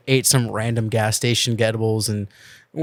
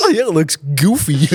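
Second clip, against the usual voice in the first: Goofy; 3 semitones higher, 4 dB louder than usual. Acted. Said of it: "gotta do it in the Goofy accent".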